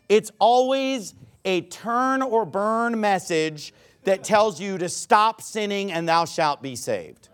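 normal talking voice

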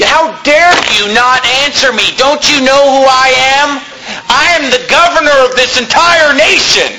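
threatening Pilate. Acted. Preacher acting out Pilate challenging silent Jesus, mildly threatening. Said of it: "Pilate is threatening Jesus and saying".

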